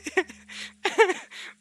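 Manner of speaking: squealing laugh; high giggle. Real, not acted.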